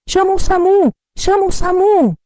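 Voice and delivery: high, loud urgent yell; urgent shouting. Acted. Relative yells for an ambulance, high urgent shouting. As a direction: shout the order in panic. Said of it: "A Fernanda gritando".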